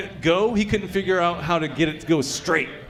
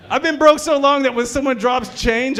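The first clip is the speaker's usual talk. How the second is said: usual speaking voice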